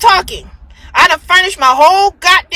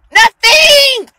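scornful shout; 7 semitones higher, 4 dB louder than usual. Real, not acted. Ranting about his new women.

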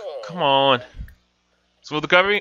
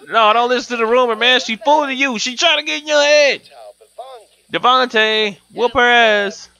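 high, loud fast pleading; frantic pleading; 6 semitones higher, 4 dB louder than usual. Real, not acted. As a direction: beg him urgently. Pleading with the game boyfriend.

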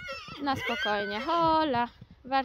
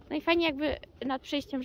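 normal talking voice

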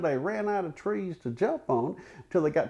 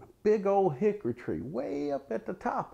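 usual speaking voice

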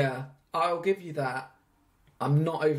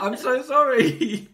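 higher, louder, slow mock-pleading sorry; exaggerated apology; 9 semitones higher, 8 dB louder, slower than usual. Real, not acted. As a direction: beg forgiveness in a hammed-up wail. Repeats apology, amplified.

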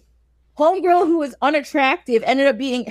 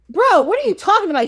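quick, higher-pitched disbelief; incredulous protest; 7 semitones higher, 3 dB louder, faster than usual. Acted. Wife answering her doubting husband, acted incredulous protest. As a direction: fire it back in mock disbelief.

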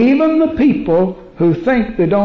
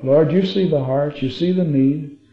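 soft, low, unhurried praying voice; closing prayer; 5 semitones lower, 5 dB quieter than usual. Real, not acted. Preacher praying for the congregation's faith and healing, hushed and devout. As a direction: pray gently over the people, letting lines fall away.

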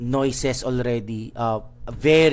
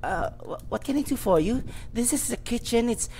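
put-on higher nervous girl voice; a crying kitchen girl greeting strangers, higher timid voice; 11 semitones higher than usual. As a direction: play a frightened young kitchen helper.

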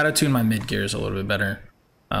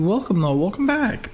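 cheery welcome; 9 semitones higher than usual. Real, not acted. Teasing a returning viewer.

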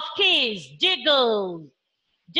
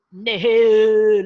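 low horse neigh; 6 semitones lower, 4 dB louder than usual. Shown as a demonstration. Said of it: "like a horse".

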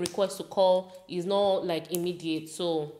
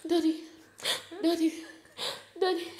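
put-on tearful child; 9 semitones higher, faster than usual. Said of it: "I said".

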